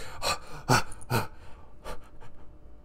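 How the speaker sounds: exaggerated, breathy gasping; mock stunned gasps. Friend caught out by the boss, mock gasps. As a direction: gasp as if caught out, hammed-up.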